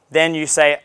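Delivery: ordinary delivery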